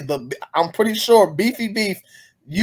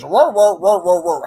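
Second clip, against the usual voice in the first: string of mock dog barks; imitated barking. Mocking a man who barks at his dog, imitated barking. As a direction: bark in a made-up dog language. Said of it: "made up his own bark language".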